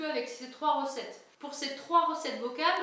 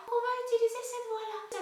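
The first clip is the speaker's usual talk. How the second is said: much higher head-voice demo; 8 semitones higher than usual. Shown as a demonstration. Coach shows the head voice she will sing with. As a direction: place it in head voice.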